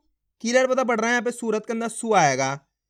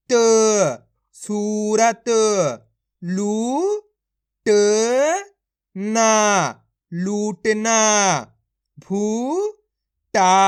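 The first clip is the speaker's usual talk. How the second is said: loud, drawn-out syllables, then the whole word; syllable drill; 5 dB louder, much slower than usual. Shown as a demonstration. Teacher showing children how syllables join, in loud drawn-out drill. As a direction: sound out each piece like a reading teacher.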